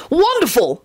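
high-pitched burst of delight; delighted, lifted high; beaming delight. A narrator's reading. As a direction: exclaim it like a thrilled enthusiast. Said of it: "Arthur beamed".

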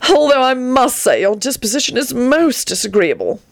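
high, stagey complaint; affected, high theatrical voice. A narrator's reading. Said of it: "Antonin sighed theatrically".

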